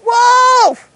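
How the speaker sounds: high, loud cry; cry of wolf. Pretend. Shepherd boy crying wolf to the town, loud. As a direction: bellow Wolf! down the hill. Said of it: "A cry of".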